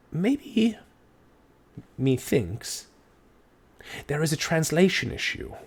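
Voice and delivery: high, arch and knowing; hint at the lie with mock politeness; sly insinuation. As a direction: constable needling the guard. Constable insinuating in character.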